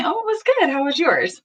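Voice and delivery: mock cheery reply, lightly acted; attentive spouse reply. Shown as a demonstration. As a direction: turn toward them gladly. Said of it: "Small turning toward".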